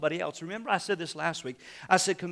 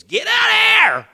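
sudden high, full-force shout; explosive, drawn-out parental yell; yelling at kids; 16 dB louder than usual. Acted. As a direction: holler it like a fed-up parent at the kids. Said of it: "You might be yelling at your kids".

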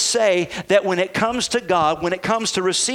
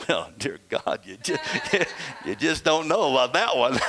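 low, soft, chuckling through the words; a quiet laughing joke about some of you; laughing aside; 4 semitones lower, 3 dB quieter than usual. Retold or imitated. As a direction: tease the congregation while laughing. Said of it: "and I've said".

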